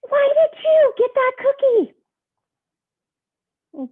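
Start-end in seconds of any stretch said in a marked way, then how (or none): 0.1-1.9 yelling pigeon. Acted. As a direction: yell at the duckling, outraged. He's yelling at him.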